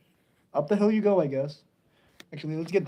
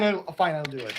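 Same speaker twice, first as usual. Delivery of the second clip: ordinary talk, nothing marked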